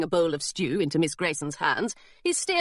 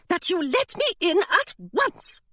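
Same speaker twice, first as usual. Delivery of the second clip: demanding voice; 10 semitones higher than usual. Acted. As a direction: stretch each word out like an order. Voice at the door.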